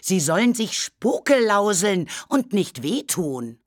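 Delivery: high, slow, emphatic reproach; indignant. A narrator's reading. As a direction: scold as an offended little ghost. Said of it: "rief das Knäuel empört". (empört und gedehnt, mit hoher Stimme)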